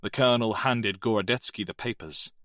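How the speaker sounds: usual speaking voice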